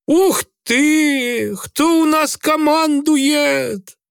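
high, slow sarcastic jeer; sneering. A narrator's reading. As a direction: twist the words with a grimace. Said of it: "скривился Грицай". (скривившись, насмешливо)

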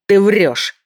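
loud, high accusing yell; angry shout. A narrator's reading. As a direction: bark the accusation. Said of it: "закричал Манкуза".

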